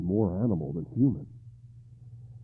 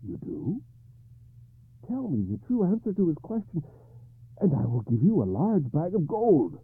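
higher, eager bargaining king; King Arthur voice; 9 semitones higher, 5 dB louder than usual. Acted. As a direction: offer gold eagerly.

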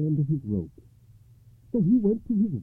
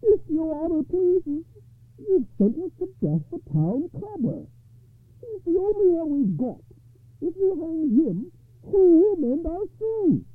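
townsman crying out; 4 dB louder than usual. A narrator's reading. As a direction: plead to the judge in a high voice. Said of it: "arose and cried out".

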